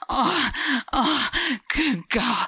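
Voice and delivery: rising moans. Acted. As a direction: let the pleasure break through every word. Climax moaning.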